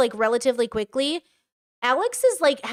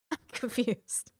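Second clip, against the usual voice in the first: much softer, slow dazed murmur; baffled mutter; 10 dB quieter, slower than usual. Real, not acted. Reacting to the boyfriend's outburst, dazed and soft. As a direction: mumble it, lost for words.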